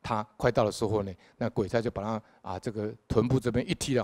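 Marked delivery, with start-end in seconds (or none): none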